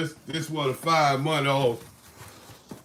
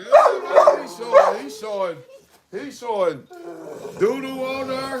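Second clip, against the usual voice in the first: much higher, louder mocking viewer voice; 9 semitones higher, 4 dB louder than usual. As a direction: squeal like a viewer pointing out the mess. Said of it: "I know they like".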